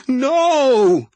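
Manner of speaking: high, loud anguished wail. A narrator's reading. Abby wails at the wizard's refusal. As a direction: wail it out in despair. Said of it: "Abby wailed".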